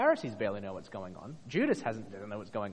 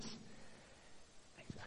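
whisper; 21 dB quieter, much slower than usual. Real, not acted. Whispered aside.

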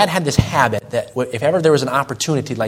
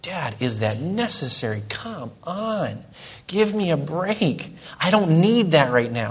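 soft, muttered to oneself; inner grumble; 2 semitones higher, 6 dB quieter, slower than usual. Retold or imitated. Son's silent irritation at dad, quiet grumble. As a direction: keep it under your breath. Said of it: "Just inside me, there'd just be like".

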